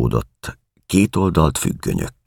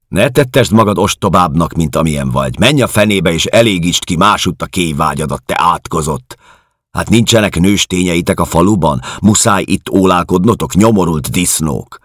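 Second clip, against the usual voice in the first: higher, louder angry tirade; hostile woman, furious; 5 semitones higher, 5 dB louder than usual. A narrator's reading. Camp woman driving a prowling man away, furious. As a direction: lay into him, cursing with contempt.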